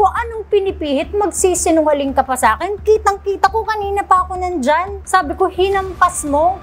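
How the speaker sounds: mother confronting maid, angry high scolding. Retold or imitated. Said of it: "minura ko ulit".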